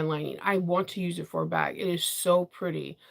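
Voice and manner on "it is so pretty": ordinary talk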